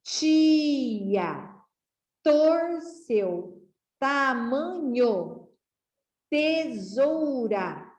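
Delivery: put-on each word drawn out slowly for children to repeat; slow read-along. Shown as a demonstration. Teacher reading a word list with young pupils, slow and clear. Vamos novamente?.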